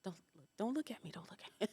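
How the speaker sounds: hushed, fast, pleading don't look at me; imitated shy whisper. Shy woman recalling the crowded shop, whispering. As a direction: whisper it like hiding from the room.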